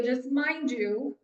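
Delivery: usual speaking voice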